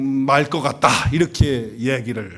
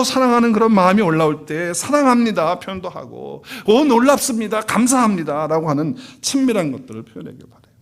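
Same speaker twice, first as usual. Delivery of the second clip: loud, higher, impassioned sermon voice; fervent preaching; 8 semitones higher, 7 dB louder than usual. Acted. Pastor urges the congregation to express love to God, fervent.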